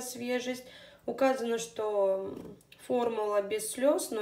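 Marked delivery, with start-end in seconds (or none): none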